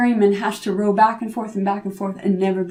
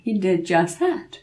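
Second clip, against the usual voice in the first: ordinary delivery, nothing marked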